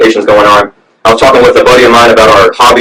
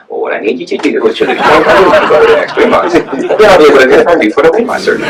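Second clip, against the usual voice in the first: strained, higher-pitched old doctor impression; old man doctor; 4 semitones higher than usual. Weight loss doctor answering a surgery patient, old man impression. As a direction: mimic a hunched-over old surgeon. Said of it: "He'd say that every time".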